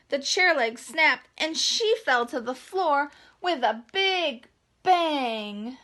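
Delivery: ordinary delivery, nothing marked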